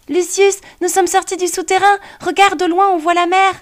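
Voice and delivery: put-on high, excited young boy; an amazed child calling to his cat; little boy voice. A narrator's reading. Boy character's voice.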